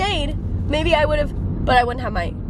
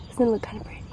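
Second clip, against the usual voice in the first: soft unvoiced whisper at dawn; 8 dB quieter, much faster than usual. Real, not acted. Whispering to viewers about the pretty sky. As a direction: hush it so you don't break the calm. Hushed while watching sunrise.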